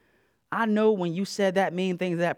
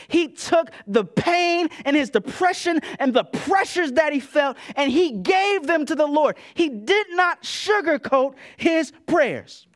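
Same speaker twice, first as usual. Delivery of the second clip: higher, louder, slow urgent preaching; preaching at full force; 10 semitones higher, 5 dB louder than usual. Acted. Pastor urging the church to pray honestly, fervent. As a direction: drive the point home, slowly.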